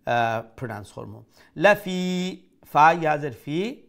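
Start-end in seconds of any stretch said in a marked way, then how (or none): 1.6-2.4 লাফি with the final i held out; one alif long. Shown as a demonstration. Teacher showing the natural madd, long final vowel in লাফি. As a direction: stretch the ending of লাফি for one beat. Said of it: "one Alif long".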